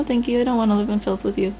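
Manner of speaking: ordinary delivery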